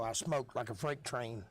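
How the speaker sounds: ordinary talk, nothing marked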